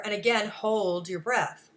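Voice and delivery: ordinary talk, nothing marked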